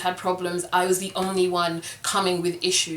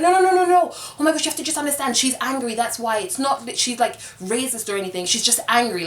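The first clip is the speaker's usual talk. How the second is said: mock frantic denial; 4 semitones higher than usual. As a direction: rush the no's up high, then settle. Castmates denying racism.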